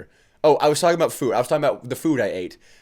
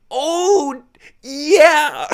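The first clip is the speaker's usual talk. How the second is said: put-on much higher, giggly toddler coo; babbling toddler chef; 14 semitones higher, 6 dB louder than usual. Podcaster imagining a chef biting a steak, toddler coo. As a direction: melt into a baby's delighted coo. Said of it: "he goes".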